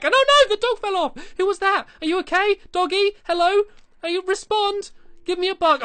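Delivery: high, loud worried calls; alarmed calling. Real, not acted. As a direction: call out for a fallen dog. Dog fell off, calling it.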